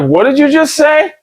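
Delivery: high-pitched disbelief; acting out a stunned double-take; incredulous. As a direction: question it in retold shock, voice jumping up. Acted shocked reply to friends.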